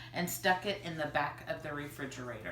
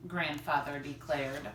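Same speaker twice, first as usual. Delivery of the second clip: plain reading voice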